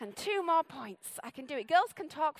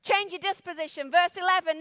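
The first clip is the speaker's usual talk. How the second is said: ordinary delivery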